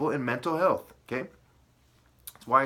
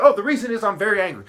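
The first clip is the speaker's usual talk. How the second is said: fast, louder, higher mock quote; mocking the shooter; 5 semitones higher, 6 dB louder, faster than usual. Ranter quoting a shooter's excuse, mocking. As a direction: rattle it off as a ridiculous excuse. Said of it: "You're like".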